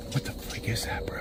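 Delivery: scared whisper. Real, not acted. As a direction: hush it, afraid to be heard. Scared of a noise in the woods.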